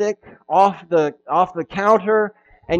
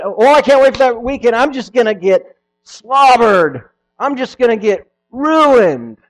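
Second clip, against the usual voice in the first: mock party boaster; 7 semitones higher, 8 dB louder than usual. Mocking a party-boasting churchgoer.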